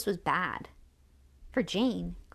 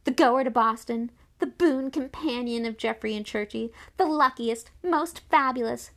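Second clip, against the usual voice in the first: high, loud, grand announcement; exuberant; 5 semitones higher, 6 dB louder than usual. A narrator's reading. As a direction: proclaim each title with a flourish. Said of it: "waving it exuberantly".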